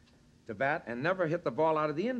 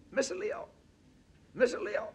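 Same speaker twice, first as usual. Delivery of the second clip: put-on much higher, pleading young ballplayer voice; high tenor voice. Manager imitating young Willie Mays pleading, in a high tenor voice. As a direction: imitate a tearful rookie calling his manager. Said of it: "he used to talk in a high tenor voice".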